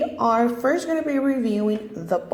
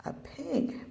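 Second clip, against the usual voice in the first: whisper; 7 semitones higher, 11 dB quieter than usual. Shown as a demonstration. Answering own quiz for child.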